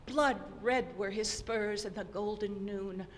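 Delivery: usual speaking voice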